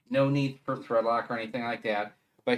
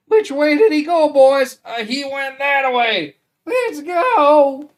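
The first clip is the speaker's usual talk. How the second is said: much higher, loud cartoon henchman shouting; Roger Rabbit henchman voice; 14 semitones higher, 16 dB louder, slower than usual. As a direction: belt it out like a goofy movie sidekick, higher and louder. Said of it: "the guy who did the voice in Who Framed Roger Rabbit".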